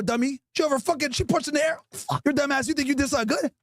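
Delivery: usual speaking voice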